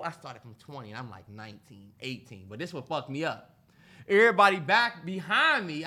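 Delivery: ordinary delivery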